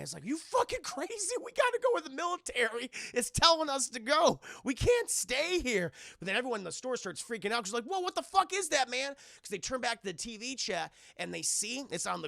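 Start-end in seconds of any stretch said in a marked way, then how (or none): 0.3-5.9 high, loud panicked shout; panicked yell. Retold or imitated. Friend yelling to flee. 7.8-9.1 high, loud startled outcry; shocked cry. Retold or imitated. Store crowd sees monster.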